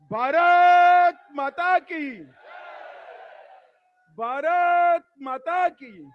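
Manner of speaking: loud, high, long-drawn rally slogans; slogan shouting. Real, not acted. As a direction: lead the crowd in a stretched-out chant. (લંબાવીને બુલંદ અવાજે સૂત્રોચ્ચાર)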